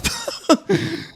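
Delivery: high-pitched burst of laughter. Real, not acted. Laughing at his own outburst at the TV, high-pitched laugh. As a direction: let a high laugh break out. Laughs after mock yell.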